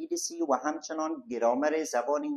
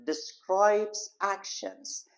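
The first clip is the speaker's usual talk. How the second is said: slow dictation; 3 semitones higher, 3 dB quieter, much slower than usual. Shown as a demonstration. Teacher dictates English definition.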